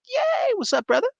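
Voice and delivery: very high, squealed little cheer. Real, not acted. Streamer greeting a viewer in chat, high-pitched delight.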